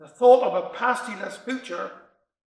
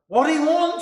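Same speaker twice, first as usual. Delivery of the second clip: sharp, higher impatient question; hard-spoken wife; 7 semitones higher, 5 dB louder than usual. Acted. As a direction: snap at the sick husband upstairs. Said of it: "a very hard-spoken woman".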